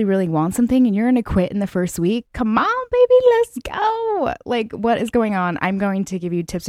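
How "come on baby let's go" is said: way higher, slow pumped-up cheer; rally them like a hype coach; stretched-out high hype call. Acted. Hyping up the listener.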